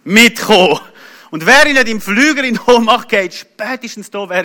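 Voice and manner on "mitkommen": loud, curt command; barked order from a border guard; customs officer's order. Retold or imitated. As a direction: call out like an official stopping someone. Customs officer calls him over.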